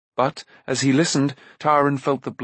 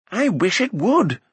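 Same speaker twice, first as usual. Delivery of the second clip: ordinary delivery, nothing marked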